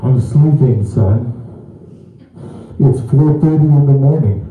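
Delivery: loud, muffled and annoyed through a mask; CPAP mask voice, annoyed. Shown as a demonstration. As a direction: scold the son from under the mask. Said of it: "you sound like this all the time".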